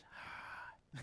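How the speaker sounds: soft, whispered exhale of a greeting; breathy reply. Retold or imitated. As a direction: let it out as voiceless breath, acting his reply. Man's wordless-sounding reply in skit.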